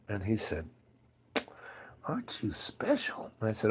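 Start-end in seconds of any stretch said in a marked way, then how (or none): 2.1-3.3 soft, airy whisper for a retold tease. Master to his would-be new friend, teasing whisper. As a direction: imitate a sly, hushed whisper.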